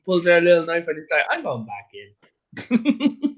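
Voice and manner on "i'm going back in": laughing retreat. Retold or imitated. Tourist retreating from knife-wielding mugger.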